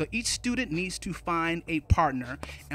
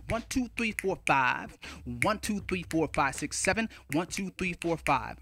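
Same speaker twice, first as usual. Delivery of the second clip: softer, singsong count with each group falling at its end; rhythmic counting; 4 dB quieter than usual. Shown as a demonstration. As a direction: count in haiku rhythm, five-seven-five. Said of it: "rhythmically it goes a little something like this".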